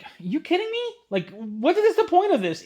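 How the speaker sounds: outraged can't-believe-it squeak; incredulous outburst. Retold or imitated. Told exclusives are gone, he cries out in disbelief. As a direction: pitch shoots way up in disbelief.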